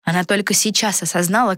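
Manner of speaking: ordinary delivery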